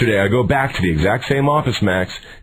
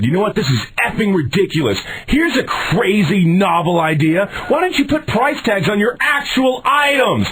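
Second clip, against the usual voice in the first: high, exasperated rant building in pitch; acted customer meltdown; re-enacted angry screaming; 9 semitones higher than usual. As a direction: retell losing it at a store clerk, slowing on the sarcasm. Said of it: "He's screaming in an Office Max".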